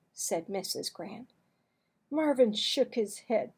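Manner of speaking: usual speaking voice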